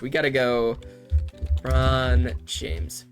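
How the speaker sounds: ordinary talk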